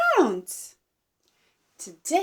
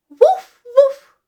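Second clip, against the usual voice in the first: two short, high, loud woofs; high-pitched double bark; dog bark; 11 semitones higher, 9 dB louder than usual. Shown as a demonstration. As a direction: yap like a dog for children. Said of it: "The dog says".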